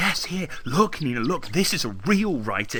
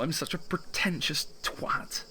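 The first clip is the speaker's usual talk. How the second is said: breathed, soft and slow; self-loathing whisper; 3 semitones higher, 4 dB quieter than usual. Acted. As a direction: hiss it at yourself in disgust. Constantine's self-loathing aside.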